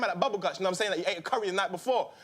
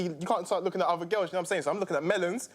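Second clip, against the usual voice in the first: ordinary talk, nothing marked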